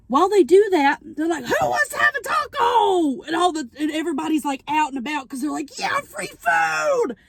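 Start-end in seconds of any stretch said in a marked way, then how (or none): 1.4-3.2 villain calling out. Retold or imitated. Villains luring people with tacos. 5.8-7.2 high, slow, whooping yell of joy; excited crowd cheer. Retold or imitated. Crowd cheering for free tacos.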